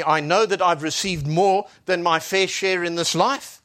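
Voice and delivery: usual speaking voice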